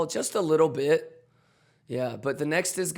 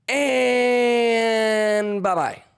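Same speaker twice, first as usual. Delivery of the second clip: drawn-out bye; 7 semitones higher, 6 dB louder, much slower than usual. Real, not acted. Mock farewell to chat.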